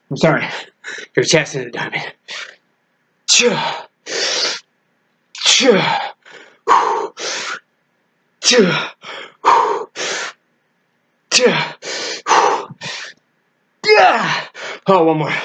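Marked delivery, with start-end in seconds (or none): 3.3-14.5 higher-pitched "cha" forced out on each rep; straining exertion cries. Real, not acted. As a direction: push out a cry with the effort, peaking on the last. Straining through diamond push-ups.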